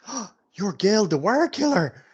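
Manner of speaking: high, bright-eyed barmaid greeting. Acted. Barmaid gushing to Gil. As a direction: gush over the hero as the innkeeper's wife.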